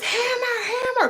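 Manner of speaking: high, loud, urgent call; calling the dog. Retold or imitated. Dad yelling after runaway dog, imitated high holler.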